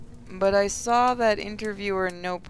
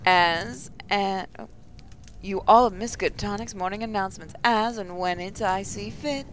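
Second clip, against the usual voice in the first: morning announcer. Acted. Mindy in the Morning addressing the students, announcer delivery. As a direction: stretch the openings like a PA host.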